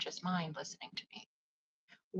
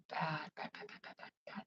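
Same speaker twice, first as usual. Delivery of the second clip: fading bad; 3 semitones lower, 9 dB quieter than usual. Real, not acted. Repeating bad, fading to whisper.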